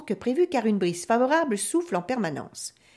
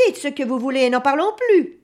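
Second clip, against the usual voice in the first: loud, raised, fed-up outburst; devil's exasperated shout; irritated; 5 semitones higher, 6 dB louder than usual. A narrator's reading. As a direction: cut the haggling short impatiently. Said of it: "s'énerve le diable".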